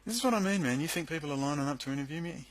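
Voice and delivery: loud, high burst of exasperation; loud sarcastic protest; exasperated outburst. Real, not acted. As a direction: throw it out in real frustration.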